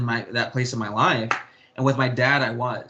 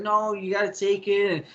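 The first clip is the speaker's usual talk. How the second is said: higher, mildly agitated mock protest; imitating upset stepdad; 7 semitones higher than usual. Son imitating upset stepdad pushing him to cash the check, mildly agitated. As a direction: mimic the stepdad insisting, a bit hammed-up. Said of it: "Oh, he was upset".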